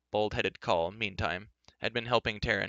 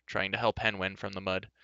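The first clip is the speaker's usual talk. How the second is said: usual speaking voice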